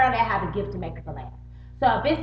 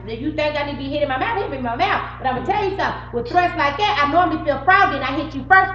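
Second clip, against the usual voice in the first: ordinary talk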